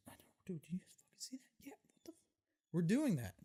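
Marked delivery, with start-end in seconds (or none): 0.1-2.1 hushed, incredulous whispering; whispered exchange. Retold or imitated. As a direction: whisper urgently to your friend, keep it secret. Silent look between friends, then whispers.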